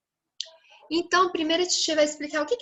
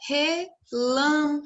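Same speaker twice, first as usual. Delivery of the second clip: relâmpago slow and higher, syllable by syllable; stress on LÂM; 4 semitones higher, slower than usual. Shown as a demonstration. Teacher showing children the stressed syllable, relâmpago slowly in separate syllables. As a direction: sound out re-LÂM-pa-go, leaning on LÂM. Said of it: "O lam é a sílaba tônica".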